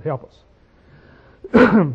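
normal talking voice

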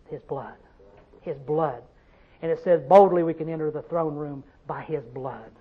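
very low, soft, reverent preaching; hushed reverence. Real, not acted. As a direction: drop right down to a hush. Preacher hushed over the blood.

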